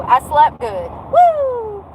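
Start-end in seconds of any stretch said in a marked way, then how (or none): 1.1-1.8 high-pitched held "woo"; high whoop. Real, not acted. Wide-awake vlogger glad of a good sleep, whooping. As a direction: let out a long, high whoop. Whooping about having slept well.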